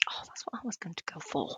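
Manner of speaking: whispered aside, under breath; whispering. Real, not acted. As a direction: breathe it out unvoiced. Whispered aside after prediction.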